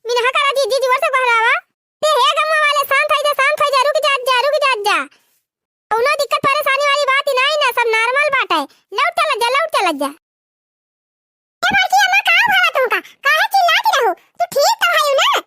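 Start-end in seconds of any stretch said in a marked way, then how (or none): 2.0-7.7 calling out. Acted. Calming charging villagers loudly. 11.6-15.4 very high, loud worried calls; calling out. Acted. Calling to shouting aunt.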